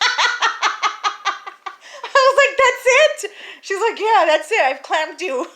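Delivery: high, loud talking through real laughter; real laughter bursting into the words; cracking up. Real, not acted. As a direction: laugh helplessly through the punchline. Cracking up at her own punchline.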